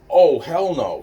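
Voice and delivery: high, loud defiant outburst; retold outburst. Girl flipping out at the adults, loud and high. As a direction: blurt it out loud like a furious kid.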